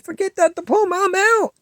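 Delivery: high and loud, tossing it away; defiant shout. Retold or imitated. Refusing stepdad's push for the diploma, defiant high shout. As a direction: fling the words back at him.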